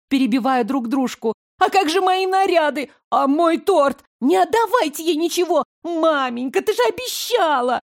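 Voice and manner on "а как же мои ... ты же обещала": high shrill squealing sisters; верещать визгливо и высоко. Acted. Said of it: "как начали верещать". (визгливые капризные сёстры)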